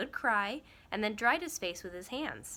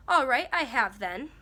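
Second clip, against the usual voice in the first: put-on louder, defiant boy who no longer cares; much louder voice; 4 semitones higher, 8 dB louder than usual. A narrator's reading. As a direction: blurt it out loud, past caring who knows. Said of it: "said Diggory in a much louder voice".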